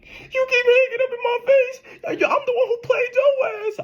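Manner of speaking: very high, wailing fake sob voice; mock crying. Imitating the ex crying on the phone, very high mocking wail. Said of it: "she sound like this".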